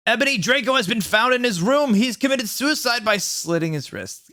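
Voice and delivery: high hammed-up announcement; grave news. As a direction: announce it like a dramatic headmaster. Dumbledore breaks the news.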